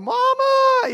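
high, loud imitated child's cry for mother; little boy calling for his mama during the fight; kids crying Mama. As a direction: wail like a scared five-year-old.

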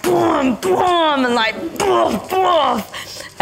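high mock blows, boom boom; hammed-up pounding noises; hitting sounds. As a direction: punch the air with each sound. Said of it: "she's like".

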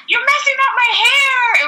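high, shouted whiny complaint; whiny Chris. Retold or imitated. Chris complaining to the girls, high whiny shout. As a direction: wail about the ruined hair.

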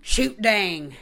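a light country drawl on "Shoot dang", voice barely changed. Retold or imitated. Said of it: "that weird fucking hick voice".

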